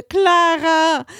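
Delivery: loud, high, drawn-out scream; horrified bellow. A narrator's reading. Bride's mother rushes toward the crushed aunt, horrified bellow. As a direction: scream out the name at full voice. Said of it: "brüllte".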